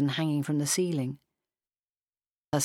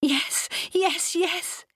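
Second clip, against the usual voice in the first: very high, breathless-with-joy yeses; tearfully overjoyed; much slower than usual. A narrator's reading. Mimi accepts his proposal, high and overcome. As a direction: accept eagerly, voice leaping up high. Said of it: "tears beginning to pour down her face".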